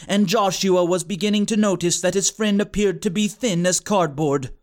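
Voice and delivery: ordinary delivery, nothing marked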